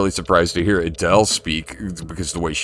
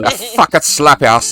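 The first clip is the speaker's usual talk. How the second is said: loud, high mock Cockney-Irish threat; hammed-up Cockney grandma faking Irish, pitched up; 9 semitones higher, 5 dB louder than usual. Imitating best friend's grandma cursing in public, loud Cockney-Irish. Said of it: "trying to fake an Irish accent".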